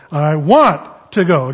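WANT punched loud and stretched; stress on WANT. Shown as a demonstration. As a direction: hit "want" hard, let "to go" fall away. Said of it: "The emphasis in this".

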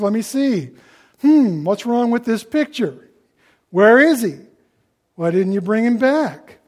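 acted indignant grilling; sarcastic interrogation. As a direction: demand answers from underlings who failed. Priests angrily question the officers.

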